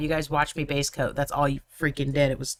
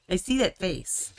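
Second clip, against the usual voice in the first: normal talking voice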